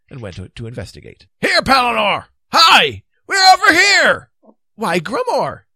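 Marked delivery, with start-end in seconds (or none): 1.4-4.3 high, full-voiced calls for attention; loud, high hailing shout. A narrator's reading. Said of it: "he was attracted by shouting". 4.7-5.7 high, loud note of surprise; pitched-up, curious exclamation; interested. A narrator's reading. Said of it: "he asked with interest".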